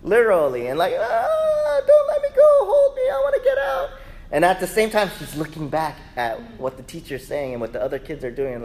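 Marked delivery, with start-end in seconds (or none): 1.0-3.9 high-pitched mock wail of a clinging child; panicked child. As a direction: cry out like a scared kid begging to be held. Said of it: "choking me, literally".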